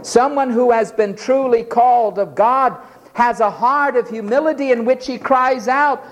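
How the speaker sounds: ordinary talk, nothing marked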